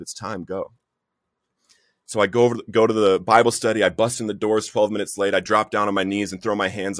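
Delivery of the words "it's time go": soft inner whisper. Retold or imitated. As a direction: softly voice a quiet inner prompting. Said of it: "he just repeats himself".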